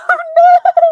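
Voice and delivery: high squeal of laughter breaking into a sob; laugh-crying. Real, not acted. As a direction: laugh through sudden tears. Laughing and crying at a chat message.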